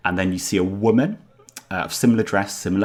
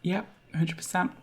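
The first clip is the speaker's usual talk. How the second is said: high, softer hamster voice; small creature confiding, pitched way up; tiny talking hamster; 13 semitones higher, 6 dB quieter than usual. Acted. Hamster answers in character.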